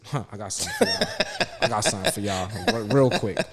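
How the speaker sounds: amused retort broken by laughter; laughing comeback. Retold or imitated. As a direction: laugh through a playful mock threat. Laughs through his retold comeback.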